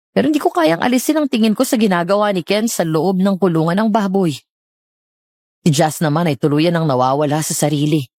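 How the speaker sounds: ordinary talk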